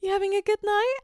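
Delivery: mock her tight polite question; imitating a pinched fake-polite woman; tight-voiced woman. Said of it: "tight ass voice".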